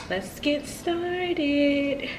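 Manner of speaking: hoarse, breathy and very slow; raspy whisper. Real, not acted. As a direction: croak it out under the breath. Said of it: "I sound terrible".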